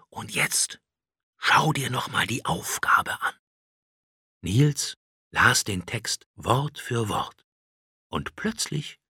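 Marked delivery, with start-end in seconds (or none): 0.1-3.3 soft, whispered, hypnotic urging; quiet, spellbinding. A narrator's reading. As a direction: hush it like casting a spell. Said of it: "mit leiser, beschwörender Stimme". (leise und beschwörend)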